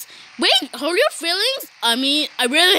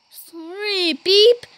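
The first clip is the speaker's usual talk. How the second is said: ordinary delivery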